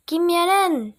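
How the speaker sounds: high, loud cheer; cheerleader shout. A narrator's reading. Cheerleaders chanting to the crowd, loud high cheer. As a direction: call it out like a cheerleader. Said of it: "shouted the cheerleaders".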